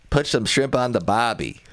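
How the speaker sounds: hammed-up stock Aussie line; deliberately bad Australian accent. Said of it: "That was pretty bad".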